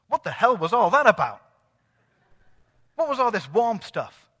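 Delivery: loud, incredulous demand; retold bewildered outburst. Woman recounting a strange sensation in hospital, bewildered. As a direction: imitate her blurting out her bafflement, loudly.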